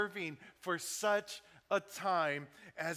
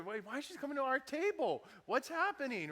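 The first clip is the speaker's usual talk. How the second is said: high, exasperated retold griping; annoyed; 8 semitones higher than usual. Waiting diner wondering why she skips the table, annoyed. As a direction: complain in a pitched-up, put-out voice. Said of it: "I'm just getting annoyed".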